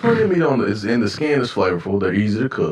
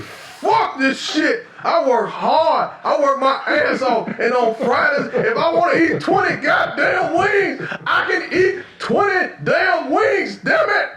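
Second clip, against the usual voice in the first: angry rant; slower than usual. Retold or imitated. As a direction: bark it like an angry dog. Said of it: "aggressive ass dogs who just barking".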